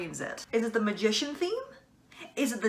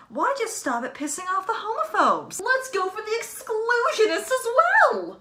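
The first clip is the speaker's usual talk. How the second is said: riled-up outburst; 12 semitones higher, 5 dB louder than usual. Real, not acted. Vlogger taking on homophobes and exclusionists, riled-up outburst. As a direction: build from high-pitched to loud, very high exclamation.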